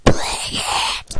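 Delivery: shouting. Real, not acted. Urging viewers to play the game.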